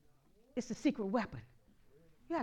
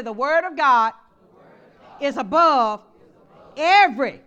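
louder, climbing every every every; call and response; 4 semitones higher, 15 dB louder, slower than usual. Shown as a demonstration. Pastor leading the church line, building loud. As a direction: build it up for them to echo.

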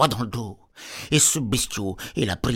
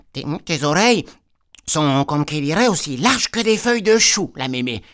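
put-on little girl voice; 3 semitones higher, 4 dB louder than usual. Girl questioning granny.

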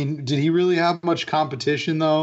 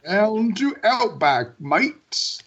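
slow, higher Australian ad voiceover; fake Australian accent; 5 semitones higher, slower than usual. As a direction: stretch out a phony Australian sales pitch. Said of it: "voiceover guy who does the Outback commercials".

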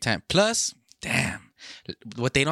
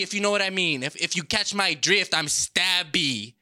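wrestling promo; 6 semitones higher than usual. Pretend. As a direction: hype it up like a heel in the ring. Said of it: "Maybe you should cut a wrestling promo right now".

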